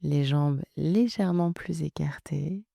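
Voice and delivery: calm, unhurried relaxation guidance with long pauses; guided meditation. Acted. Coach settling listeners into yoga nidra, calm guided-meditation voice. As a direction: guide them gently into rest, leaving room to breathe.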